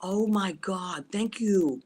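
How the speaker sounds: ordinary delivery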